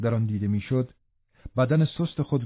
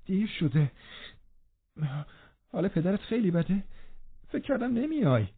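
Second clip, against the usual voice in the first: put-on young man's voice, higher and softer, rushed with effort; hurried, strained; 7 semitones higher, 6 dB quieter than usual. A narrator's reading. Sickly Linton urging Catherine, hurried and strained. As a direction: push the words out fast and with difficulty. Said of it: "خیلی تند و به دشواری گفت".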